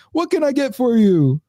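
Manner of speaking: high, drawn-out mock Caribbean voice; terrible Caribbean accent. Deli worker greets a customer, terrible Caribbean accent. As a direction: stretch it out in a badly faked Caribbean accent. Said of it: "do the fucking Caribbean accent".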